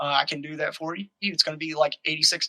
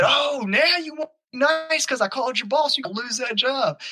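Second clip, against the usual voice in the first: high-pitched imitation of a gloating homeowner; retold taunt, pitched way up; mocking retort; 8 semitones higher than usual. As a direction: jeer back in a much higher voice.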